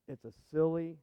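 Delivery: ordinary delivery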